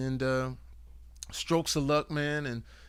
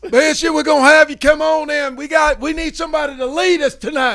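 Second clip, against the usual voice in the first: high, loud Texas coach; 14 semitones higher, 16 dB louder than usual. Retold or imitated. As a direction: bark it like a high school coach. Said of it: "That coach, that coach voice".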